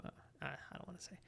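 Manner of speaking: whispered aside. Real, not acted. Muttered aside after scolding chat.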